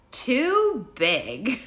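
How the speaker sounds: mock-serious child's verdict, high and loud; Zoe. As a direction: imitate a little kid's solemn ruling. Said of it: "so serious about it".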